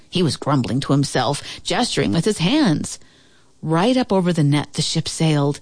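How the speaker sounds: usual speaking voice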